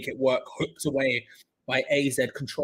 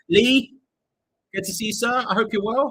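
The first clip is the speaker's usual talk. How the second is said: higher, louder, bright hello; higher cheery hello to a viewer; cheery greeting; 7 semitones higher, 6 dB louder than usual. Real, not acted.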